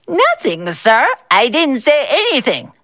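high, sneering copy of a stammer; mocking imitation. A narrator's reading. Boss mocking the clerk's excuse, in a sneering high voice. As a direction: mimic his excuse to belittle him. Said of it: "Mister Bao mocked".

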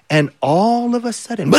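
ordinary delivery

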